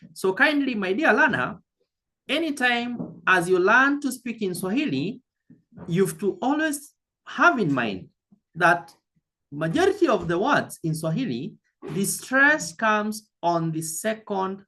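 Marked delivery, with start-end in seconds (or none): none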